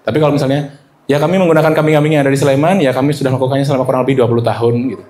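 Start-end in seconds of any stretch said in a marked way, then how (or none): 1.1-4.7 fast, throwaway "dua puluh tahun"; rushed, no emphasis. Shown as a demonstration. Presenter pitching goat milk too fast, meaningless. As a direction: gabble "dua puluh tahun" past with no weight. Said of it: "Nggak ada makna apa-apa".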